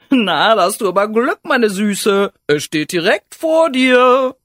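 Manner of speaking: high, very loud hedgehog suitor; cheeky hedgehog, boastful. Acted. Hedgehog offers himself to the fairy, cheeky and boastful. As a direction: belt it out proudly, drawing out the end.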